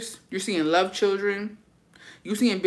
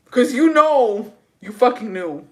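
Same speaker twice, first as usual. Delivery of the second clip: louder, higher, slow and heated; worked-up outburst; 6 semitones higher, 8 dB louder, slower than usual. Real, not acted. Fuming at the coworker who should have known better, loud and slow. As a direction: let the anger spill over every word. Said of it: "I'm worked up".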